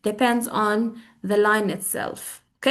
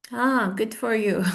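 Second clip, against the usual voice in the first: unenthusiastic; slower than usual. Shown as a demonstration. Teacher shows how not to congratulate, unenthusiastic. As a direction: drag it out without enthusiasm. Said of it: "Not like".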